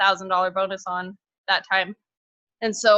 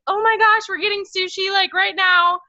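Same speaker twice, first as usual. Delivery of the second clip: much higher and louder, excited rush; thrilled husband; 10 semitones higher, 4 dB louder than usual. Retold or imitated. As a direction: celebrate on the spot, overjoyed. Husband thrilled, sushi plan.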